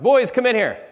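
loud high yell from inside the house; calling out. Retold or imitated. Dad calling his sons in from inside the house, loud yell. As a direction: call the boys in at full voice. Father yelling to boys.

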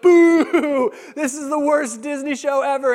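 high, loud heckling; mock booing. Crowd hearing the show is canceled, mock outrage. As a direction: boo like an outraged audience member.

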